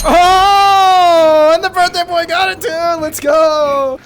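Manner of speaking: screaming with joy; excited shout. Real, not acted. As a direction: yell the win out loud. Birthday win celebration shout.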